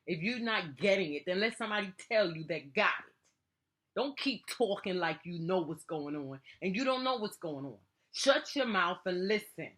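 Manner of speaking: loud, high, heated telling-off; angry scolding. Real, not acted. Parent ordering a child to listen, angry scolding. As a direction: scold hard and shut down any interruption.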